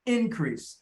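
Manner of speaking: "Increase" stressed on IN; bigger first syllable. Shown as a demonstration. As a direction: make the IN of "Increase" big, then drop. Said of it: "Make this in bigger".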